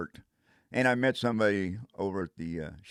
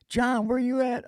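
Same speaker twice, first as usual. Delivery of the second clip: high, slow voice of a friend calling; Mark on the phone; 14 semitones higher than usual. Retold or imitated. Mark calling the lost friend, high slow voice. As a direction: play Mark asking where he is.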